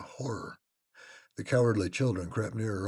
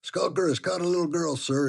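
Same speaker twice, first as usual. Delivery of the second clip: loud, high report to the master; loud servant; 10 semitones higher, 9 dB louder than usual. A narrator's reading. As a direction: call it out loud and urgent. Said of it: "he replied".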